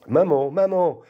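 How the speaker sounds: put-on high childlike call for mother; lifted, pleading child's call. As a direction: cry out like a little girl.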